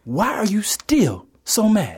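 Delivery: slow, spaced-out, careful question; pointed question. Acted. Radio host questioning the Mad Rapper, pointedly deliberate. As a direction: ask it deliberately, word by word.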